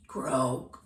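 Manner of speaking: low croaking frog voice. Acted. Auntie croaking like the frog for the kids, low. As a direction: croak it like a bullfrog.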